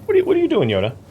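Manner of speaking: sudden high amused outburst; surprised. Real, not acted. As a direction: caught off guard, laughing at him. Player startled by odd character.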